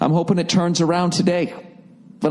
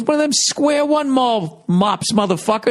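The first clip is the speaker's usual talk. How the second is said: mock angry outburst; 7 semitones higher than usual. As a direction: imitate a friend snapping back, half-hearted. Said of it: "he starts flipping out on me".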